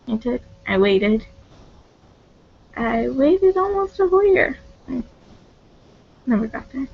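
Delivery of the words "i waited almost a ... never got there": real crying. Real, not acted. Telling viewers how long she waited for him, crying. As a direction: voice breaking high into tears. Confessing rejection, breaks down.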